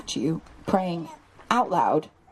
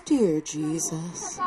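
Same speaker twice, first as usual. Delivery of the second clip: lower, much slower, softer solemn intoning; formal prayer voice; 5 semitones lower, 4 dB quieter, much slower than usual. Shown as a demonstration. What kids hear from parents, slow solemn prayer voice. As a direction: drag it out like a stiff church prayer. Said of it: "All our kids see is".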